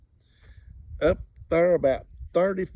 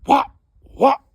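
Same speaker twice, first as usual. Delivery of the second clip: loud, high thumping noises; dog thumping under car; 11 semitones higher, 11 dB louder than usual. Retold or imitated. Dog hit under the braking car, loud thumping noises. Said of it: "I hear that dog going".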